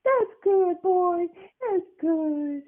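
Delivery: put-on high quavering old-lady falsetto; Grandma voice. Grandson imitating grandma praising him, high and quavering. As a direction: croon it like a doting granny. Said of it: "And she'd like".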